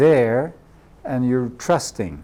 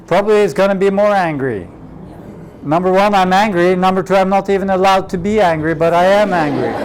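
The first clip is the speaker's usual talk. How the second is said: louder and higher, fast, building frustration; rising anger; 6 semitones higher, 5 dB louder, faster than usual. Acted. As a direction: work yourself up, faster and louder.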